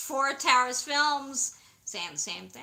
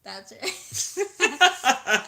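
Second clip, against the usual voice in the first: laughing; 4 semitones higher, 10 dB louder than usual. Real, not acted. Laughing reply.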